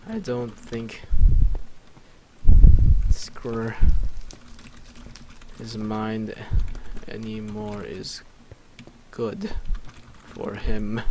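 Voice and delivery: broken up by long pauses; slow and halting. Real, not acted. Player composing a line aloud, slow and halting. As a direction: piece it out slowly, bit by bit.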